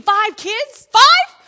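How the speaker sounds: can't believe the number; shocked disbelief. Real, not acted. Disbelief at five kids.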